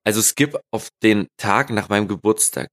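ordinary talk

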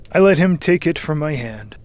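ordinary delivery

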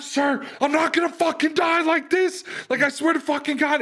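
high, strained yelling protest; rowdy defiance. Retold or imitated. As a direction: re-enact shouting back at a superior. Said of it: "as I'm getting rowdy".

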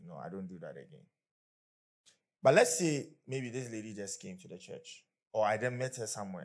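ordinary talk, nothing marked

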